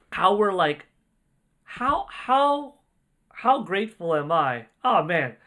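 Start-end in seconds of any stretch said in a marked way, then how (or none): none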